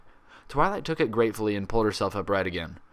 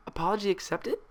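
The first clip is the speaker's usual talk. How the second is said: unsure; 11 semitones higher than usual. A narrator's reading. Twilight unsure response.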